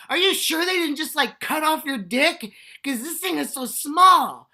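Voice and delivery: high, loud, incredulous mock voice; teasing girlfriend. Girlfriend in bed mocking the comic, high loud teasing. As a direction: squeal it like a girlfriend gawking. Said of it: "She was like".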